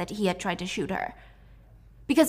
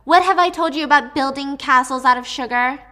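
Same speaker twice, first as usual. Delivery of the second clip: scolding mom; 5 semitones higher, 7 dB louder than usual. Acted. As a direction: call her full name like a mom catching her kid. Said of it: "And her mom said".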